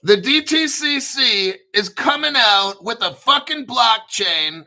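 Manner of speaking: excited announcer blast; hyped shout. Shown as a demonstration. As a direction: sell it like breaking news. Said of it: "Is it more clear?".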